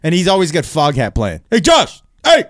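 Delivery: high, puckered-lip calling over the fence; neighbor impression. Comic doing his neighbor calling him over the fence, high and eager. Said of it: "pretty good impersonation of my neighbor".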